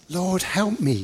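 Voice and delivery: high-pitched plea for help; pleading. A narrator's reading. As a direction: beg the master for mercy. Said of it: "as she pleaded".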